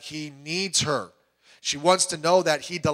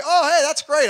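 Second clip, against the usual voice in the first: fake cheer; 11 semitones higher, 8 dB louder than usual. Father hides alarm from daughter.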